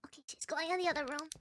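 quick, very soft whisper; low, hurried secretive report; hushed lookout. Real, not acted. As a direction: whisper a hurried update while hiding. Tracking threat while hiding.

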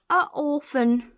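high, slow, drawn-out sympathy; hammed-up sorrowful exclamation; exaggerated pity. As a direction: stretch it out in storybook pity. Burglar pities the abandoned baby.

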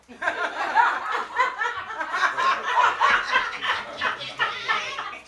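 a long rising squeal of a laugh; delighted high laugh. Pretend. Preacher acting out a clubgoer hearing their song, high laughing. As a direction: laugh high and free like the song just came on.

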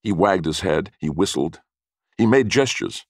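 plain reading voice

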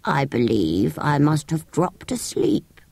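low, slow drowsy creature; sleepy sand fairy. Acted. Sand fairy waking up, low sleepy voice. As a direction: yawn it out just waking. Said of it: "stretching itself".

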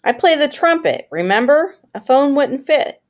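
high-pitched pretend indignation; mock offended. A narrator's reading. Band kid answering a friend's teasing, mock offended. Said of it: "pretended to be offended".

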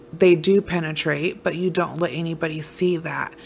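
ordinary delivery

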